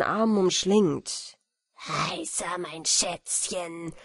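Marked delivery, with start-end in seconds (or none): 1.8-3.9 low, slow, panting villain voice; panting monster. Acted. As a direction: leer at her with heavy breath. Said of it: "keuchte er dabei".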